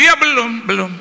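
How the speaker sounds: high, loud quick reply; shouting into the phone. Retold or imitated. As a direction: call it out over a bad line. Shouting into weak phone signal.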